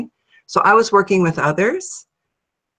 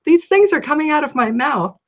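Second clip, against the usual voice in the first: ordinary talk, nothing marked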